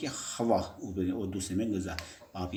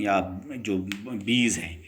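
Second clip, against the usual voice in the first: ordinary delivery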